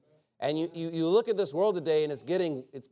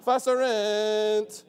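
slow, drawn-out chanting of mock Latin, pitched higher; mock Latin priest; 6 semitones higher, 4 dB louder, much slower than usual. Preacher mocking a Latin mass nobody understands, slow and drawn out. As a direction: intone it like a droning old Latin mass. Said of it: "if I'm just up here like".

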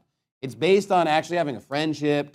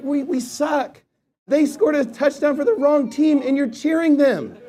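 higher, louder disbelieving complaint; incredulous protest; 10 semitones higher, 4 dB louder than usual. Retold or imitated. Fan tells cheerleaders they cheer the wrong team, higher and incredulous.

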